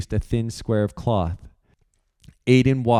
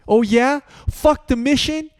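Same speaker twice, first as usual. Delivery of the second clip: much higher, louder, throwing "Fuck the mission" back as a sneer; mocking yell; 4 dB louder than usual. Aiden yelling George's words back at him. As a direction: yell it over someone's shoulder. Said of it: "Aiden yelled over Flux's shoulder".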